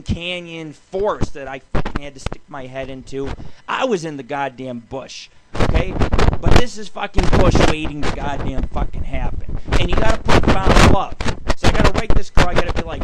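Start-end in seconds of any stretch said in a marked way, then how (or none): none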